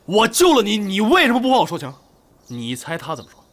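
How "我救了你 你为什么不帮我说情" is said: louder, higher angry reenactment; indignant table-thumping tirade, retold; fired-up retold anger. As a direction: act out hauling someone over the coals. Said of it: "我一去我就火了".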